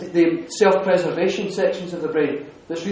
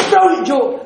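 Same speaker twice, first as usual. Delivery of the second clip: higher, loud attention-grabber; loud announcement; 6 semitones higher, 4 dB louder, slower than usual. Retold or imitated. Cutting through a row in the living room, loud. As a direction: call it out over an argument. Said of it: "like, louder than I went".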